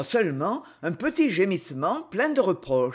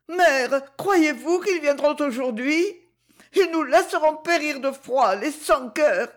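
put-on higher, anguished girl's voice; desperate cry; 8 semitones higher than usual. A narrator's reading. Zina crying to her mother in despair. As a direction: cry out bitterly against the heartless neighbours. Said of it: "cria Zina d'une voix désespérée".

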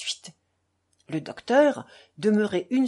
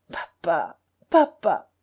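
choked voice; 4 dB louder, slower than usual. A narrator's reading. Dying boy calls his father, voice choking. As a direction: call papa as the throat tightens. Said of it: "Sa voix s'étrangla".

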